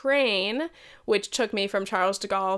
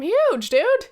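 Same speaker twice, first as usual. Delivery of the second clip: exclaimed dude; 11 semitones higher, 7 dB louder than usual. Real, not acted. Complaining about huge airport.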